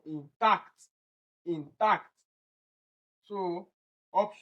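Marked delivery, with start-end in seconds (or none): 0.0-2.1 "Intact" with weight on TACT; stress on second syllable. Shown as a demonstration. Teacher confirms the second one, stressing last syllable. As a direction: keep IN small, hit TACT in "Intact". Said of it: "It is also the second one".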